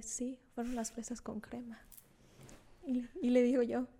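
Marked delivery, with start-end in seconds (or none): 0.0-1.8 whispered quote. Retold or imitated. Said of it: "Me dice".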